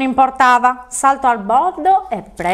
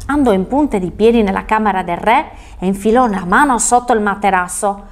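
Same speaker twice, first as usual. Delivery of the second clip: ordinary delivery, nothing marked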